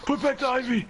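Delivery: high, hammed-up distress; panicked pleading. Patient begging James to put back the IV, mock panic. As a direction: plead urgently, pitch well up.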